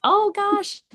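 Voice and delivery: high, squeaky mock dread. Retelling her nerves with a skeptic, a high mock-dread squeal. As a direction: squeal it like you're dreading what comes. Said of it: "and it's like".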